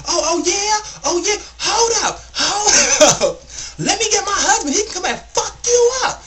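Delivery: very high, loud mock screeching; rowdy. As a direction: imitate a worked-up woman hollering threats. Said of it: "she's getting just real rowdy".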